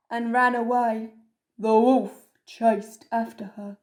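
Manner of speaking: ordinary delivery, nothing marked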